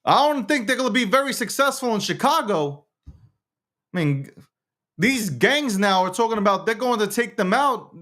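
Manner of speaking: high-pitched exasperated questioning, easing down gradually; baffled disbelief. Real, not acted. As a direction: keep the voice high and incredulous. Baffled rant about the situation.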